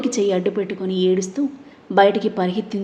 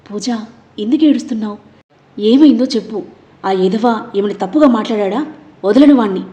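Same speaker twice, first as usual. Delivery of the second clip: louder, rising into anger; worried then furious; 6 dB louder than usual. Acted. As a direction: worry boiling over into rage at the guy. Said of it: "అని ఆవేశంగా". (ఆందోళన నుండి ఆవేశంగా)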